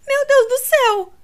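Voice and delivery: high, loud horrified exclamation; almost shrieking in shock; near scream. A narrator's reading. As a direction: Edwina cries out at the injury. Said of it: "exclamou Edwina, quase gritando".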